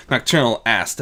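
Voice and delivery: ordinary delivery, nothing marked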